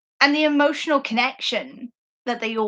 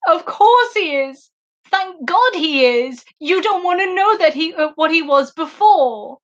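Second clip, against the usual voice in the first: retold tearful outburst; 5 semitones higher than usual. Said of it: "I burst into tears and started sobbing".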